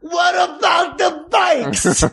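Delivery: hammed-up startled shout; mock shout. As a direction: belt it out in disbelief. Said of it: "I go".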